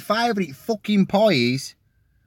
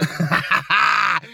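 real mid-story laugh; burst of laughter; 3 semitones higher, 3 dB louder than usual. Real, not acted. Comedian amused at his own pie order, real burst of laughter. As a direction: break off into a laugh.

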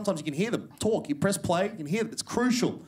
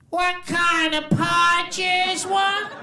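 much higher, louder, slow put-on girl's voice; shrill pie-shop girl; 13 semitones higher, 4 dB louder, much slower than usual. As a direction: squeal the question, high and drawn out. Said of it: "she goes".

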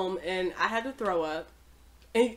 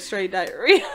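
ordinary delivery

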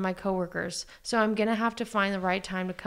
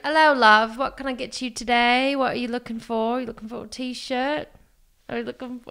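put-on British accent; 3 semitones higher, 3 dB louder than usual. Salesperson greeting a customer on the sales floor, British accent. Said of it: "try to do an accent".

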